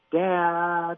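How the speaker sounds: lightly voiced kid calling for dad; a hint of a child calling out; imitating a child who needs help. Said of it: "we know the voices of our children".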